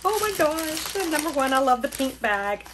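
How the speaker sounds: normal talking voice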